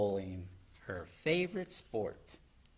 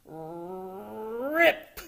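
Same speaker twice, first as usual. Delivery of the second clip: rolled, rising rrrip; imitated ripping sound; 7 semitones higher than usual. A narrator's reading. Froggy tears open the box, rising ripping noise. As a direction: draw out the R like tearing cardboard.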